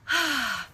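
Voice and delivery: audible, falling sigh of relief; relieved sigh. A narrator's reading. Iona in the story lets out a relieved sigh. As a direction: let out a long breath in relief.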